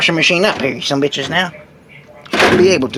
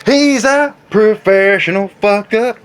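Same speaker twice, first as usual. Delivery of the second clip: normal talking voice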